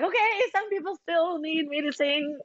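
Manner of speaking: bright, high relieved exclamation; high excited quote. Retold or imitated. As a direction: pitch it up, light and eager. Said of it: "So I was like".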